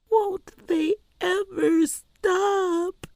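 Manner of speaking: put-on high, slow crying child; whimpering. A narrator's reading. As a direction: sob out the question. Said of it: "she whimpered".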